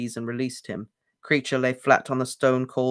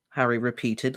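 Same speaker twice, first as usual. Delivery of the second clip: ordinary delivery, nothing marked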